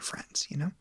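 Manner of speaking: soft breathy whisper. Shown as a demonstration. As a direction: trail off into a whisper. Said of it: "just kinda low-key".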